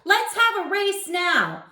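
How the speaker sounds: eager challenge. A narrator's reading. Tommy challenging Darren.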